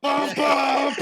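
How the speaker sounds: high, shouted imitation of orchestral blast; mock blaring score. Imitating sudden blaring movie score.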